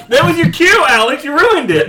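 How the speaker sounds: laughing and shouting at a friend; loud laughing scold. Real, not acted. Friend missed her cue.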